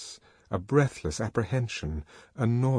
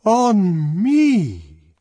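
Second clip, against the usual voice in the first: high, loud, stretched outrage; outraged wail; indignant repetition; 11 semitones higher, 9 dB louder than usual. A narrator's reading. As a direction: repeat it louder in affronted disbelief. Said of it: "the arch songster kept repeating".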